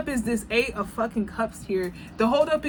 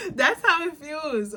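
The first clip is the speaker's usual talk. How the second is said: laughing through the words, a bit higher; slower, real laughter in the voice; 11 semitones higher, 7 dB louder, slower than usual. Real, not acted. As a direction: say it while still cracking up. Laughing after her own joke.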